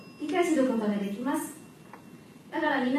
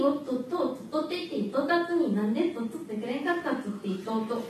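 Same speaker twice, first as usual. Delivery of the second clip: Fukuoka-dialect patter, tripping along; Fukuoka tongue twister; faster than usual. Shown as a demonstration. Student demonstrating to the audience, Fukuoka-dialect tongue twister. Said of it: "福岡弁の早口言葉を紹介したい".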